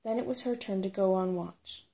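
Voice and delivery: usual speaking voice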